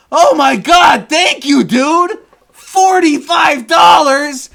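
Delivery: high, loud, stretched-out joyful shout; thrilled yell of gratitude; overjoyed shout. Retold or imitated. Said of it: "a little exaggerated, barely exaggerated from how I reacted".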